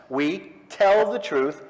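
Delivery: slow, tell leaned on and falling; stress on tell. Shown as a demonstration. Lecturer repeating the tenet to the room, tell stressed slowly. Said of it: "emphasis on the tell".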